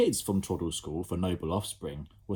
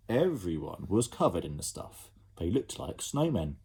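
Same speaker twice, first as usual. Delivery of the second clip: ordinary delivery, nothing marked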